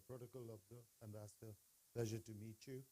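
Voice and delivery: normal talking voice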